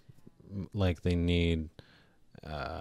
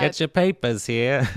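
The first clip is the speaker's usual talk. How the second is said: newsboy calling out papers; old-timey voice; 6 semitones higher, 6 dB louder than usual. Acted. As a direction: hawk papers like a 1930s newsboy. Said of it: "You're trying to do, like, an old-timey voice".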